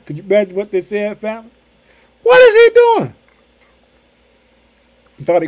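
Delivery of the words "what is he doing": high, loud frightened cry; panicked disciples. Retold or imitated. Disciples on the boat crying out at a ghost, high and loud. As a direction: shriek it like scared men in a boat.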